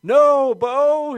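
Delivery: high, full-voiced shout to the dog; retold shout. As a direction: shout out in alarm, drawn out and high. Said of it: "I shout out, I shout".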